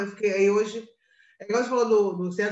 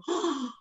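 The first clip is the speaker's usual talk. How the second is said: sharp gasp of realization, acted out; startled gasp; 5 semitones higher, 5 dB quieter than usual. Mother realizing she forgot the vitamina, retold startled gasp. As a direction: gasp as if suddenly remembering.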